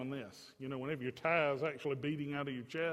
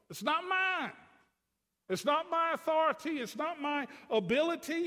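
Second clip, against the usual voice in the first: high and loud, hammering each phrase; preaching at full force; 8 semitones higher, 4 dB louder than usual. Acted. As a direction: drive the point home from the pulpit.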